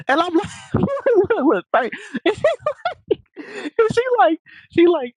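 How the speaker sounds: high, squeaky laughing speech; helpless real laughter pushing the voice high; cracking up. Real, not acted. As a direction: try to keep telling it through laughter. Cracks up while retelling.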